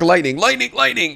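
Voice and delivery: excited call. Acted. Calling lightning round.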